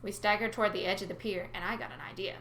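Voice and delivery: plain reading voice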